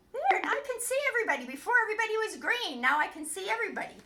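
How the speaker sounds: high, loud, fast and delighted; thrilled puppet rattling it off, high and loud; excited puppet voice. Acted. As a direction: burst out with joy at seeing clearly. Puppet Grim Grim with new glasses.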